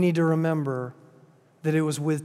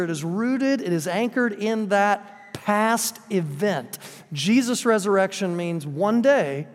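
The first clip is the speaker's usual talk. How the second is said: ordinary talk, nothing marked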